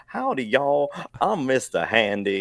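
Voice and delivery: high, loud, hammed-up American Southern drawl; a British dev mocking an American Mister Handy voice; mock American accent. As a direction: ham up a cowboy American accent like a butler robot. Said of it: "putting things out there in our British accents as Americans".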